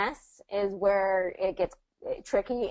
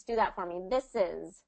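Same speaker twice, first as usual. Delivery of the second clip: ordinary talk, nothing marked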